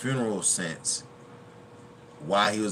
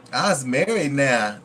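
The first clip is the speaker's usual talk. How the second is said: slow, drawn-out mock quote; imitating a movie character, slow; imitating her line; 5 semitones higher, 7 dB louder, slower than usual. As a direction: stretch it out like the character. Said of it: "That's how she said it".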